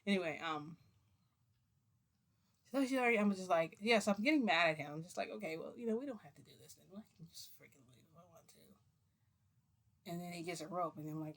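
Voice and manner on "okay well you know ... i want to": fading to very soft; retold sulky retort, trailing down to almost nothing; resigned retort. She tells him they can stop, resigned and fading.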